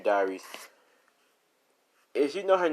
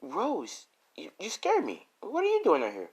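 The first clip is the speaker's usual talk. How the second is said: high, startled after a gasp; jumpy, pitched-up surprise; girl startled by Rose in the woods, high and jumpy; 10 semitones higher than usual. Acted.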